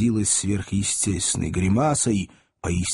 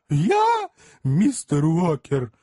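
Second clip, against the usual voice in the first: shocked innocence; 7 semitones higher, 3 dB louder, much slower than usual. A narrator's reading. Job feigns injured innocence.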